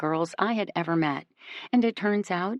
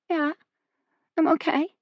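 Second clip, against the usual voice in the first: voice cracking; 11 semitones higher, 4 dB louder than usual. A narrator's reading. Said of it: "Her voice cracks".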